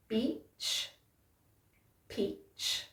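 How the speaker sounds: peach, beach, peach mouthed very softly, lips pressed hard on the P; exaggerated P and B. Shown as a demonstration. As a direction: overdo the lip shape, almost voiceless. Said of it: "one more time".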